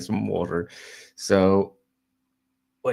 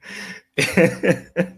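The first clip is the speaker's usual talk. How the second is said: high, loud real giggling; amused laugh; 10 semitones higher, 5 dB louder than usual. Real, not acted. Still tickled by the window leap, high giggly laughter. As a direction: let the laughter bubble up again. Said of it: "that's what I was laughing at".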